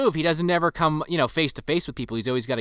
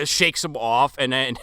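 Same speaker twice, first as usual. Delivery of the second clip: ordinary talk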